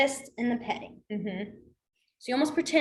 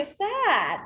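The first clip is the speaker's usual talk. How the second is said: mismatched sad demo; 4 semitones higher than usual. Shown as a demonstration. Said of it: "you're not gonna go".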